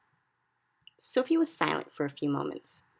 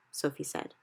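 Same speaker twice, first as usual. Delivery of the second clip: usual speaking voice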